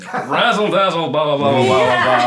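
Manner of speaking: loud, showy radio razzle-dazzle voice; old-time radio announcer. Retold or imitated. Co-star teasing the director's radio bit, loud showy announcer voice. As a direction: ham it up like a vintage radio host. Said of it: "I was so enjoy".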